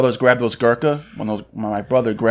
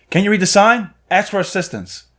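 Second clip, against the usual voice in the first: higher-pitched, snippy retort; imitated curt scolding; 8 semitones higher than usual. Clerk to brother grabbing expensive cigars, imitated scolding. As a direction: mimic a rude clerk telling someone off.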